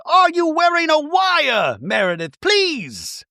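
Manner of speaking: high, drawn-out mock plea; hammed-up disbelief. Acts Bronwyn's dramatic plea.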